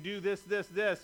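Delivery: God stacking up demands, voice building; insistent divine command. Acted. Pastor voicing God giving commands, building insistence.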